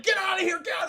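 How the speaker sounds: high, loud hurried yell; mock urgent shout. Brothers freeing all the prisoners, high urgent yell. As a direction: shout everyone out in a mock rush.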